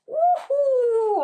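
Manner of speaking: high, loud "ouhou"; excited whoop. Real, not acted. Tea lover delighted by the flavour description, high whoop. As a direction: let out a long high-pitched whoop.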